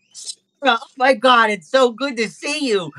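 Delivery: high-pitched, delighted greeting; gushing little monkey. Acted. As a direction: squeal hello to your long-lost crush. GM voices monkey Pete.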